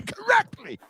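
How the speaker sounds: a touch of mock-posh on one word; posh Queen's English. Shown as a demonstration. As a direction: show off the proper Queen's English, mock-grand. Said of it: "I talk the Queen's English".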